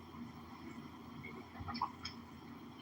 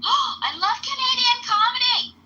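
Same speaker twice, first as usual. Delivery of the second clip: much higher, louder and slow, thrilled; delighted; 6 dB louder, slower than usual. Real, not acted. Hearing the show is Canadian comedy, thrilled gasp. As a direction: gush about it with a gasp.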